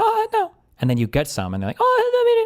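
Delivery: high put-on voice of someone begging a taste; mocking high voice. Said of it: "You're like".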